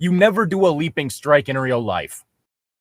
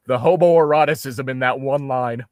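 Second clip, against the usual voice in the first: usual speaking voice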